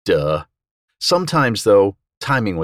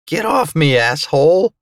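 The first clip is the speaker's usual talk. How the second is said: strained, high-pitched angry outburst; raging; 8 semitones higher, 3 dB louder than usual. A narrator's reading. Fighter to vampire attacking him, raging. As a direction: rage it out, pitched well up. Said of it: "I raged through my hallucinations".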